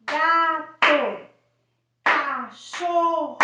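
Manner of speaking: put-on loud, slow, spelling out each word for a child; playful word demo. Shown as a demonstration. Teacher showing parents a word game, loud and slow. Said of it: "E aí você pode brincar".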